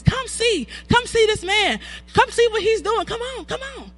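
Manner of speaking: put-on eager child, high voice; high, urgent kid calling friends; hurrying someone. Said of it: "that's what kids do".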